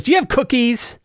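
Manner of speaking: put-on high innocent child voice; kid asking. Kid on a griffin asks the players for cookies, high and childlike. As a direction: ask sweetly in a high kid voice. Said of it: "And the kid goes".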